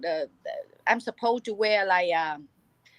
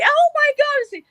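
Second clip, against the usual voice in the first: high, loud mock squeal; excited fan squeal; 5 dB louder, slower than usual. Young fans swarming comedian for a hug, excited squeal. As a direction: gush like a starstruck fan.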